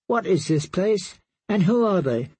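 uneasy little princess asking her knight; lightly voiced, puzzled young girl; confused child. Myrcella voiced in the story.

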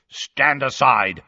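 slow, rough-voiced order. A narrator's reading. Death Eater about to open the sealed door, rough voice. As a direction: give the order slowly in a rough voice. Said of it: "said a rough voice".

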